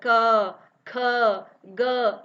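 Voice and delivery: क ख ग hit hard and loud; letters said with strong breath; forceful letters. Shown as a demonstration. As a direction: punch each of क ख ग with force. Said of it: "यहाँ पे हम जोर दे रहे हैं".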